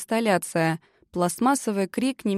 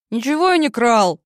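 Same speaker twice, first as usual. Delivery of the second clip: indignant, louder protest; 3 semitones higher, 7 dB louder than usual. A narrator's reading. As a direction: protest the accusation hotly. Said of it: "возмутился Бен".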